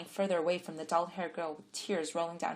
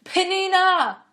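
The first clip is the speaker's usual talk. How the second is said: loud, high, drawn-out call across the camp; 18 dB louder, much slower than usual. A narrator's reading. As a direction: call her name into the crowd. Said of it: "Adiver called out".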